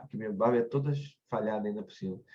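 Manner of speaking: normal talking voice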